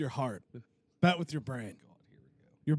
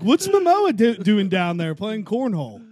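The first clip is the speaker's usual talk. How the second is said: high, loud disbelieving yelp; amazed; 4 semitones higher, 7 dB louder than usual. Retold or imitated. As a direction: shout it in mock disbelief. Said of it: "I'm like".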